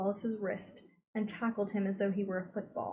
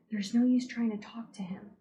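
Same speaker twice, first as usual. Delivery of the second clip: plain reading voice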